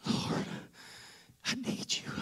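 breathy, soft plea to God; whispered plea. Acted. Mary at Jesus' feet, whispering her plea. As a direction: barely voice it, desperate.

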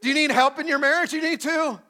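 loud, high call for the room to answer; call and response. Pretend. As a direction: shout it like a song leader wanting a reply. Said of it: "Play along".

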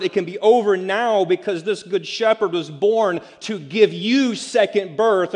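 loud, high preaching climax; full-force preaching. Acted. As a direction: preach it out to the whole room, building.

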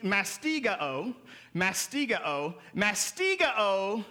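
mastigao said slowly, syllable by syllable: mas-ti-ga-o; careful pronunciation. Shown as a demonstration. Preacher teaching the congregation a Greek word, slow and deliberate. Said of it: "It's masti".

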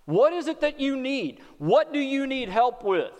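ordinary talk

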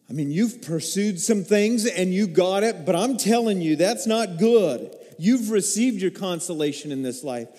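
ordinary talk

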